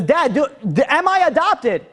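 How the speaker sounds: high-pitched, agitated demand; insistent, rising in pitch as he stumbles. Retold or imitated. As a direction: act out a son getting worked up at his dad. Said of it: "the prince is starting to raise his voice".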